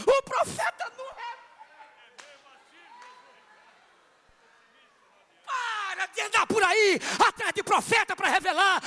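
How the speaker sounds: loud, high, full-force preaching; fiery preaching. Acted. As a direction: preach it to the whole hall.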